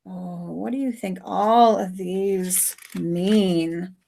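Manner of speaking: normal talking voice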